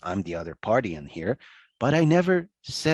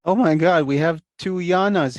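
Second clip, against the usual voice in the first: louder, higher, a happy exclamation; delighted surprise; 7 semitones higher, 8 dB louder than usual. Real, not acted. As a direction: react with glee at the names on the screen. Sees familiar names on call.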